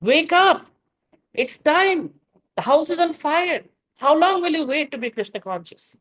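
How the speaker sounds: high, urgent wake-up call; urgent rousing call; shaking them awake. Acted. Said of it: "devotees need to be shaken up".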